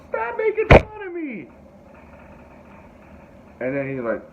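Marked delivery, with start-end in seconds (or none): 0.1-1.5 screamed high and loud, slowly; furious yell. Retold or imitated. Jason slamming his hand down at the teasing kids, angry scream. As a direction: yell it like a kid who has had enough. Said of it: "he slams his hand down and goes".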